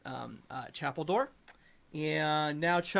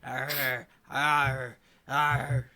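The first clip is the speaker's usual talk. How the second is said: monster growl; 4 dB louder, much slower than usual. Acted. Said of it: "he says".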